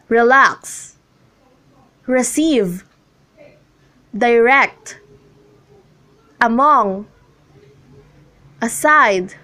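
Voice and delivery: reLAX, reCEIVE, diRECT, aMONG, aSIDE, second syllable stressed. Shown as a demonstration. Teacher reads examples Relax, receive, direct, among, aside, each stressed on the second syllable. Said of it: "the stress is on the second syllable".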